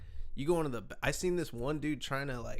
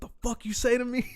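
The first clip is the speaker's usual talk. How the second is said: high, loud, fast challenge; mock angry confrontation; 12 semitones higher, 7 dB louder, faster than usual. Startled guy at the urinal confronting the singer, loud angry challenge. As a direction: bark it like you're squaring up.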